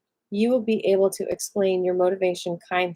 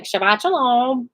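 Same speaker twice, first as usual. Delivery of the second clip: louder, higher, imitated perfunctory greeting; mock rote greeting; 4 semitones higher, 5 dB louder than usual. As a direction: mimic people tossing it out because everyone does. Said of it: "I'm saying this 'cause we all say this".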